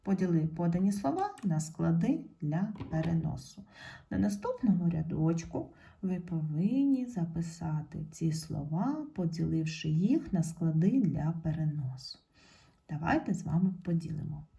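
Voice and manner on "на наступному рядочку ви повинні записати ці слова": ordinary delivery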